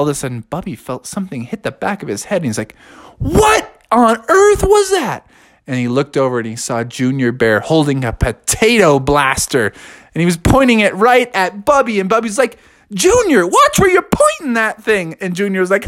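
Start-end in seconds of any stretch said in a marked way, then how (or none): 3.2-5.3 high, drawn-out indignant cry; slow, high-pitched bewildered exclamation; startled outrage. A narrator's reading. Bubby hit on the head. 12.9-15.2 high-pitched telling-off; raised, indignant character voice; alarmed scolding. A narrator's reading. Bubby scolds Junior aiming at him.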